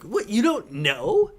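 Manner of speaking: high, loud, slow incredulous whine; mock disbelief. Mimicking his girlfriend's shocked disbelief over Harry Potter. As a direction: drag it out like she can't believe you. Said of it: "You're like".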